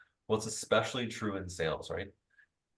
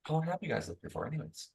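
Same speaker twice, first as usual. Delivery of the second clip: higher, softer curious question; 7 semitones higher, 4 dB quieter than usual. Shown as a demonstration. Salesperson asking a homeowner, softly curious. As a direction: ask it like you really wonder. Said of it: "the curious tone".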